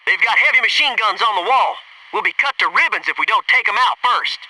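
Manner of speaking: ordinary talk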